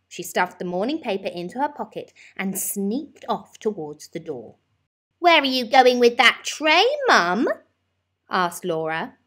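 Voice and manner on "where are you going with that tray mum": put-on child's voice. A narrator's reading. Child asks mother.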